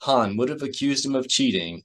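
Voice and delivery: plain reading voice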